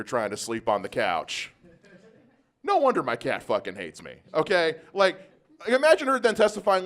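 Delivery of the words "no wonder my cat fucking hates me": normal talking voice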